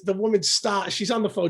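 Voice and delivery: normal talking voice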